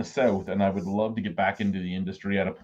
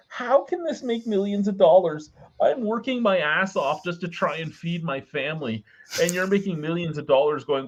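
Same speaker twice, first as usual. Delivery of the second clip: incredulous rant; 10 semitones higher than usual. Real, not acted. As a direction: rant in outraged disbelief. Incredulous rant about online earnings.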